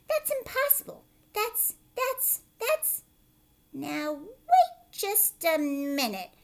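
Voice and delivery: high, loud disbelieving cries; astonished aunts. Acted. The aunts watching the peach grow. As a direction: sputter in amazement.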